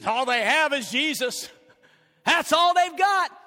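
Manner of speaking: a loud, emphatic quote from the community; imagined townsfolk saying it. Pretend. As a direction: proclaim it like the whole county is saying it. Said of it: "people of Garland County will say this".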